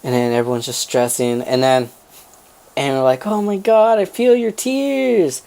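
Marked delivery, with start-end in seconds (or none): 3.3-5.4 high, drawn-out mock weepy voice; melodramatic lament. Family feeling the dog's tears, melodramatic mock crying. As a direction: imitate an overwrought wail of sympathy. Aunt's overdramatic lament retold.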